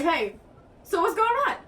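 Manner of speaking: high sweet put-on greeting; high mock greeting. Imitating the aunt greeting the girls, high and bright. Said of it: "Let me reenact this".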